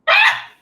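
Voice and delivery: short high burst of laughter; sudden laugh. Real, not acted. Her own burst of laughter.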